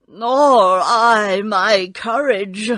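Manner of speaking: loud, slow lion lament; Cowardly Lion. A narrator's reading. Lion despairing of his courage, slow loud voice. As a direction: drag it out loudly as a big beast. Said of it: "asked the Lion".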